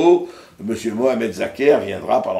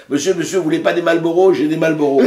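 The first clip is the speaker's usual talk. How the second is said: insistent street tout; 7 semitones higher, 6 dB louder than usual. Retold or imitated. Street tout's escalating pitch, retold.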